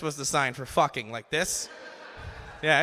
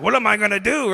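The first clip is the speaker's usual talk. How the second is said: higher, louder burst of panic; mock freaked out; 5 semitones higher, 8 dB louder than usual. Boy finding no toilet paper, mock panic. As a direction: act out a quick freak-out. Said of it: "freaked out".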